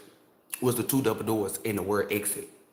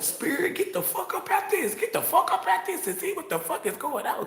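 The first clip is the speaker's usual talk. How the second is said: much higher, laughing, frantic; freaked-out laughing plea. Retold or imitated. Telling her spirit to leave her body, laughing and frantic. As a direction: squeal it through laughter like you're losing it. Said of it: "so I'm like".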